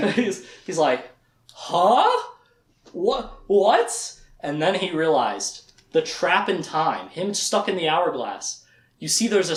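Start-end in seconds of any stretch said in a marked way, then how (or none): 1.5-4.2 high, rising questions; stunned disbelief. Acted. Richard hearing Warren is centuries old, high stunned disbelief. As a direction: blurt each one in mock shock. Acted shock at Warren's age.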